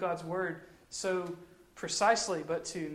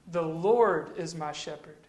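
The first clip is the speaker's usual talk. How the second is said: "Lord" drawn out and punched; stress on Lord; 2 semitones lower, slower than usual. Shown as a demonstration. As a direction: lean slowly and firmly on the word "Lord". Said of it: "put the emphasis on a different word".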